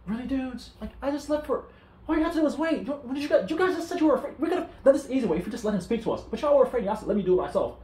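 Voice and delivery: high, exasperated scolding of the crowd; exasperated anger. Retold or imitated. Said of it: "So Moses got mad".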